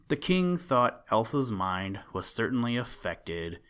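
ordinary delivery

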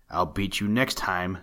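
plain reading voice

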